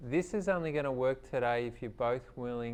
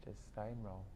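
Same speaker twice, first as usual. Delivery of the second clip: quiet aside; 3 semitones lower, 13 dB quieter than usual. Real, not acted.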